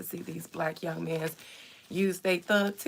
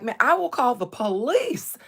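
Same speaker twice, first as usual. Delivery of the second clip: louder, higher mock threat; imitated young streamer; 5 semitones higher, 5 dB louder than usual. Streamer mimicking a young man threatening to call police, indignant. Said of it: "he was like".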